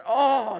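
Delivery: high loud oh of pain; pained groan. Retold or imitated. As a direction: moan at the sore nose. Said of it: "and I".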